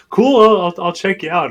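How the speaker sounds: bright, high Minnesota accent imitation; mock the kid's Minnesota accent. Kid answering golfers in a Minnesota accent. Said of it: "in, like, a very Minnesota accent's like".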